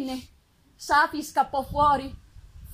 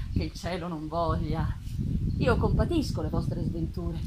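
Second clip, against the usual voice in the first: put-on lower, gentle old man; a lower, kindly old man voice; old man's voice; 9 semitones lower, 3 dB quieter than usual. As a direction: answer softly as a kind old man. Blind old man answers creature.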